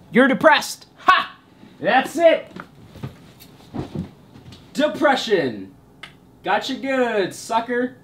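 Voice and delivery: high-pitched mocking therapist voice; gloating therapist. Therapist telling patient the diagnosis, gloating. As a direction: crow the diagnosis in a silly high voice.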